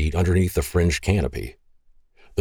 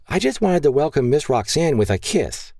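put-on little girl; 9 semitones higher than usual. Grace explains to her father.

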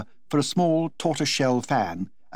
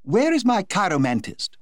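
higher, bright society hostess voice asking eagerly; Lady Windermere eagerly looks around the party; 8 semitones higher than usual. Acted. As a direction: call out for him like a hostess who wants her toy. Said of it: "said in her clear contralto voice".

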